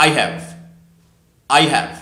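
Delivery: "I have" blended into "I've"; "I have" as one word, I've, twice; short form of "I have". Shown as a demonstration. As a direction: model "I have" as I've, pausing for repetition. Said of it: "বলো আবার".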